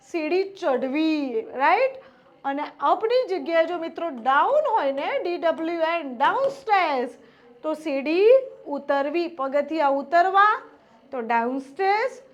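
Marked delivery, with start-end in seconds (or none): none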